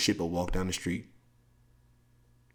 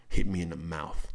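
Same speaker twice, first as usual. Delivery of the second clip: ordinary talk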